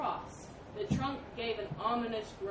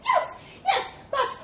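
very high, loud squeak; flustered little mouse; 10 dB louder than usual. Acted. Mouse cornered by the elephant, high and flustered. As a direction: squeak agreement while trying to object.

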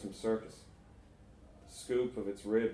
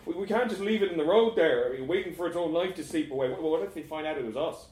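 high, hurried, stammering objection; anxious protest; 6 semitones higher, 5 dB louder, faster than usual. Acted. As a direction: plead urgently with your friends.

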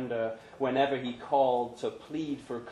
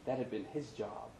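plain reading voice

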